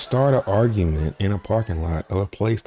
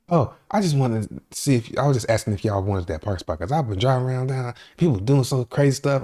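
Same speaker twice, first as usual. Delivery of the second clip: fast, much higher, jittery rush; talking all fast; 7 semitones higher than usual. Retold or imitated. Coked-up woman rushing her excuse, fast and high. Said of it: "she's talking all fast".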